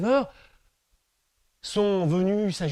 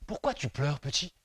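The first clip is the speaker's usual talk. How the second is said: gentle tree; 4 semitones lower, 7 dB quieter than usual. Acted. Tree speaks softly to child.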